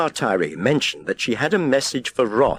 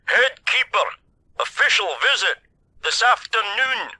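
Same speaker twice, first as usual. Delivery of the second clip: shouting; 13 semitones higher than usual. A narrator's reading. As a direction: shout each phrase clearly. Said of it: "shouted Cromarty".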